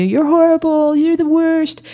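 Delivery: high-pitched mock coach yell. Speaker imitating a coach yelling at a player, mock yell. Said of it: "as the coach yelling at you".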